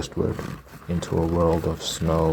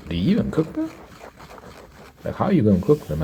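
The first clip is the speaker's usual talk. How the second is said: much higher, appalled questioning; grossed-out disbelief; 10 semitones higher, faster than usual. Real, not acted. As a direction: ask it high and appalled.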